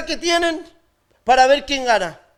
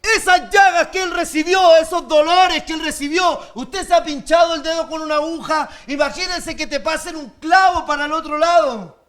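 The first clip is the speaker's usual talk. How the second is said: preaching at full force; 4 semitones higher than usual. Acted. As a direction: hammer the crucifixion home to the pews. Preaching on crucifixion.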